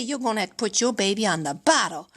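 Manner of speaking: low imitation of a doctor; grave pediatrician. Pediatrician breaks bottle news to mother, low and grave. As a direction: drop low to deliver the bad news. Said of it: "the pediatrician looked me right in the eye".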